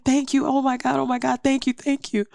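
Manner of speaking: high, repeated outpouring of thanks; retold rush of grateful relief; overwhelmed gratitude. Retold thanks at good surgery news.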